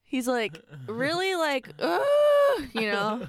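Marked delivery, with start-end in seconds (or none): 1.8-2.6 high, loud imitated yell; long mock scream; Brandon. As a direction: cry out, mimicking his scream. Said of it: "That was a good Brandon impression".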